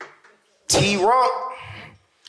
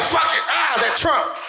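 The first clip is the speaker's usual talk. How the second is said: exasperated believer; 6 semitones higher than usual. Retold or imitated. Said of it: "you ready to just be like".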